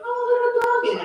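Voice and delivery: high, sing-song cooing at a puppy; kids' baby-talk; cooing kids. Retold or imitated. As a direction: coo like an excited child at a little dog. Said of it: "They're like".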